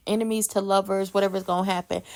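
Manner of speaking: normal talking voice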